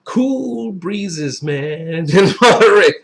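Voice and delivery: hammed-up laid-back California accent breaking into a laugh. Wine reviewer joking about Monterey grapes, mock California accent. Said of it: "in my best California accent".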